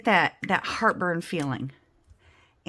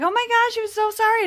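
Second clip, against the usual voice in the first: much higher, louder, gushing apology; flustered caller; 6 dB louder than usual. Retold or imitated. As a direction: play the embarrassed caller squeaking out sorry. Caller Julie apologizing, retold.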